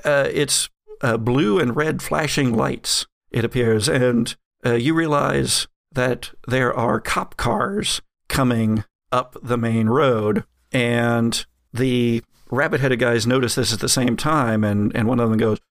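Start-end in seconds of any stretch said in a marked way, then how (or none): none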